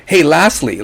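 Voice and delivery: usual speaking voice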